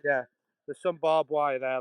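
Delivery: ordinary delivery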